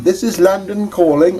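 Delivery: high-pitched vintage radio call; old-time BBC announcer. Acted. Reviewer mimicking old BBC radio, announcer impression. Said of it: "the B B C of old".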